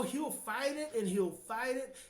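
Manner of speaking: usual speaking voice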